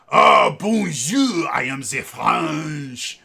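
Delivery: loud, drawn-out mock-French. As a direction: ham up a fake French accent, slowly. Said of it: "Nobody in France speaks that way".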